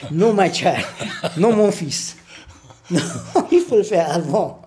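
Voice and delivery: real laughter breaking into the words; laughing. Real, not acted. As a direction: retell it while cracking up. Laughing while retelling mother's reply.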